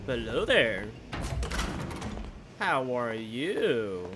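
low, slow put-on greeting; deep slow voice. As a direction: drawl a low hello.

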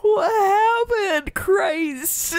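high, drawn-out, loud mock dismay; hammed-up wail of disbelief; exaggerated dismay. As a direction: stretch it out slowly, imitating shocked concern. Retold reaction to crying friend.

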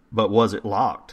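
plain reading voice

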